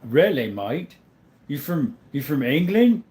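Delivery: put-on British or Aussie-sounding accent; lightly hammed-up mock British accent. Teasing a mate about England, mock British accent. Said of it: "Best British accent".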